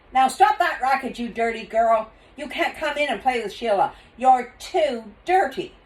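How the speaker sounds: high, loud scolding; scolding father. Acted. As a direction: tell off the noisy girl. Father scolds dirty girl.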